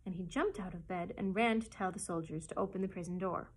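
usual speaking voice

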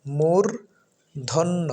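মূর্ধন্য very slowly, pause after mur; syllable by syllable. Shown as a demonstration. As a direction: split মূর্ধন্য into mur, then dhonno. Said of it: "শুদ্ধ উচ্চারণ হচ্ছে".

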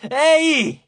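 high, loud yell; a sudden shout to startle someone; yelled in an ear. A narrator's reading. Annabeth yells into the goddess's ear, loud shout. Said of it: "urlandole in un orecchio".

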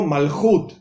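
"Malchut" as mal-CHUT, ending in t; stress on last syllable. Shown as a demonstration. Teacher gives the correct Torah reading, final stress. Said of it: "es la pronunciación correcta".

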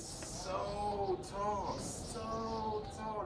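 "so tall" stretched long and pitched high; drawn-out emphasis. A narrator's reading. Storyteller showing how tall the hill is, high drawn-out emphasis. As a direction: draw out "so tall", high and slow, both times.

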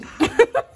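sudden high-pitched laugh. Real, not acted. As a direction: burst out laughing, high and bright. Laughs after saying oh no. (tiếng cười cao bật ra)

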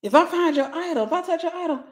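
ordinary talk, nothing marked

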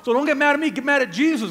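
ordinary delivery

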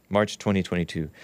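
usual speaking voice

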